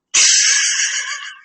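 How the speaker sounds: shrill burst of breathless laughter; high squealing laugh. Real, not acted. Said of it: "I can't keep a straight face".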